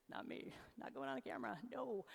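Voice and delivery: mock reluctant, quick and hushed; acting out a half-whispered, sighing refusal. Speaker mimics eye-rolling audience member refusing the camera, reluctant. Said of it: "you're like".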